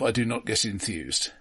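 usual speaking voice

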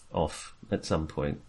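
ordinary talk

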